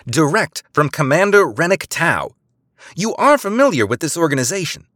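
high, weary authority; spy impatiently name-dropping her commander; dramatic exasperation. A narrator's reading. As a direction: sigh it out like a burdened spy. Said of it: "Eliza sighed dramatically".

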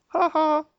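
high theatrical ha ha; a hammy villain laugh; mock triumphant laugh.

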